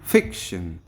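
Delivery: Fiction with ফিক hit loud; teacher modelling fiction, strong first beat; stress on first syllable. Shown as a demonstration. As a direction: punch the opening ফিক of fiction. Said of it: "জোরে stress করব".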